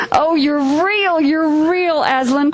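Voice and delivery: high, slow, overjoyed repetition; joyful cry. A narrator's reading. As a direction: cry out in wonder and relief. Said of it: "cried Lucy".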